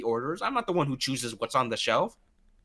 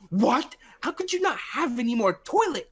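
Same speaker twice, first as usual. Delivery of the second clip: mock indignant customer; 11 semitones higher than usual.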